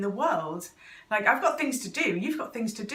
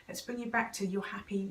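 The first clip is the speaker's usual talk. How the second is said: ordinary delivery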